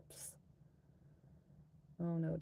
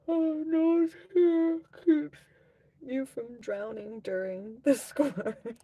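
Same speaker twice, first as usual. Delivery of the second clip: high, loud laughing squeal; shrieking laugh; 9 semitones higher, 7 dB louder, slower than usual. Real, not acted.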